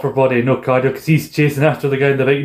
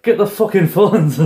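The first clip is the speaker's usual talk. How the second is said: mock yell; 7 semitones higher, slower than usual. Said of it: "he's going like".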